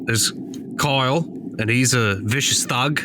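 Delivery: ordinary talk, nothing marked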